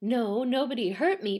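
plain reading voice

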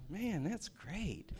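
slow, soft, unenthused mock praise; dry sarcasm. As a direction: pretend to be pleased while laughing. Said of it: "And I was like".

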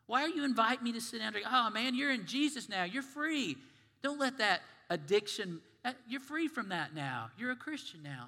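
high, startled protest that fades to a hush; a touch of panic from the tempted friend; lightly voiced pleading friend; alarmed recovering alcoholic. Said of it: "And you go".

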